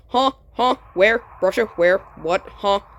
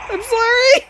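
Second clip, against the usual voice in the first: high yelled apology; 11 semitones higher, 5 dB louder than usual. Real, not acted. Apologizing mid-fight in game.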